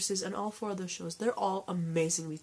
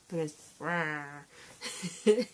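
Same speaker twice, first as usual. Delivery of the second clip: stuffy nose from a cold; blocked nose; 2 semitones lower, faster than usual. Real, not acted. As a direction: talk through a blocked nose. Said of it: "I can't breathe through my nose".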